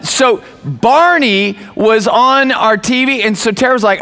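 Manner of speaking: normal talking voice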